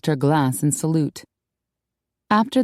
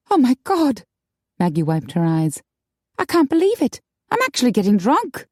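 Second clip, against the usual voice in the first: put-on higher, brighter girlish voice; high young woman voice; 7 semitones higher than usual. A narrator's reading. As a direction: play the upset young woman. Maggie tipsy.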